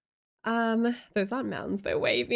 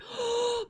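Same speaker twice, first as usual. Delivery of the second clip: gasp; 14 semitones higher than usual. Real, not acted. Spots a viewer in chat.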